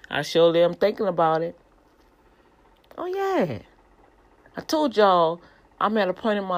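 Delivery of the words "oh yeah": high squeaky burst of delight; giddy high oh yeah; delighted squeal. Real, not acted. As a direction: squeal it out with glee. Delighted about angel tattoo.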